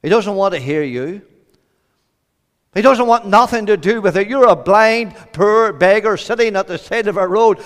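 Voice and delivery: acted crowd shouting someone down; sharp rebuke. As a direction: scold a nuisance out of the way. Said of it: "they rebuked him".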